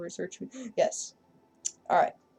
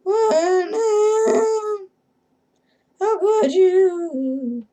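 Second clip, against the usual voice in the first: hammed-up sobbing, much higher and louder; mock wailing; 11 semitones higher, 5 dB louder, much slower than usual. Streamer mourning Leningrad to Lenin, hammed-up wailing. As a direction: wail it like a betrayed lover, over the top.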